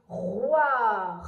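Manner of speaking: "roi" stretched and loud, closing on a long R; blending with final R. Shown as a demonstration. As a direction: hold every sound of "roi", adding an R at the end. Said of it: "le R à la fin encore".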